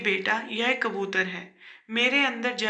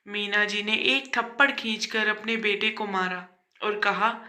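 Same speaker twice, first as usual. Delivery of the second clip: ordinary delivery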